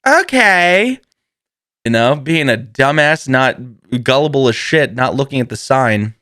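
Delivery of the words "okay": squeaky high agreeable okay; high bright okay. Retold or imitated. Answering the friend with a squeaky high okay. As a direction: chirp it up high, naive and trusting. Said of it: "I said".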